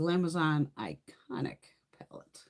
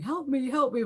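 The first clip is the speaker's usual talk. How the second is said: much higher mock yell for help; hammed-up distressed cry from the show; 10 semitones higher, 3 dB louder than usual. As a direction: imitate the TV voice crying out, pitched up. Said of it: "the person is yelling".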